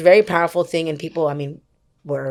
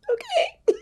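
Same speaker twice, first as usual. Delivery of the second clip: stunned okay; 3 dB quieter than usual. Retold or imitated. Overwhelmed reply after trauma verdict.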